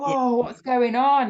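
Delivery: imitating one's own thrilled surprise; excited. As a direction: stretch out an excited "whoa". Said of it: "I actually felt excited".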